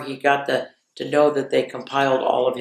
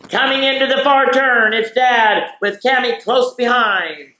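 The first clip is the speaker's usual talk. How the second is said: raised, excited play-by-play call; race announcer; 5 semitones higher than usual. Acted. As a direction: call the start like a horse race. Mock horse-race call of family morning.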